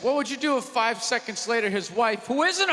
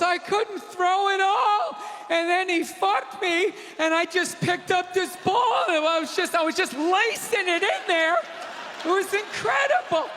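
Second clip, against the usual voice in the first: much higher, airy bragging wife voice; mock wife; 9 semitones higher than usual. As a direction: gush like a clueless wife. Mocking quarterback's wife.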